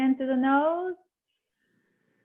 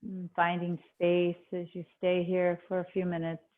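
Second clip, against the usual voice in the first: calm meditation voice, long pauses between phrases; guided meditation; 5 semitones lower than usual. Acted. Yoga teacher easing students into breath awareness, guided meditation. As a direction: settle them gently inward, leaving space after each instruction.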